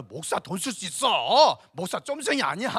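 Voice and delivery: loud, stretched-out protest; emphatic mock-indignant rebuttal; defiant boast. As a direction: declare it proudly, drawing out the endings. Acted rebuttal to memory of insults.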